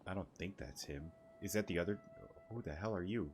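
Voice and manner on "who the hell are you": normal talking voice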